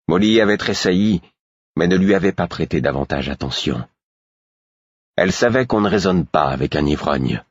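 ordinary delivery

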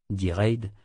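ordinary delivery, nothing marked

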